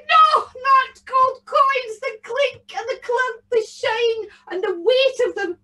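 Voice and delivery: put-on very high, loud wailing old woman; an old lady pretending to be horrified; mock-distressed old lady. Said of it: "the old lady said".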